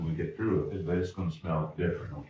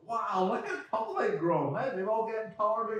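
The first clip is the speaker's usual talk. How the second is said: amazed parents. Retold or imitated. Parents greeting the returning kids, high amazed wonder. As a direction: marvel like a parent seeing the kids after months. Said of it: "we look and go".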